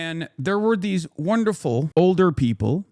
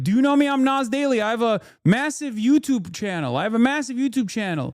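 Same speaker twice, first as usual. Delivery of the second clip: pitched-up mocking brag; mock yelling; 7 semitones higher than usual. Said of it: "yelling with a phone camera in tow".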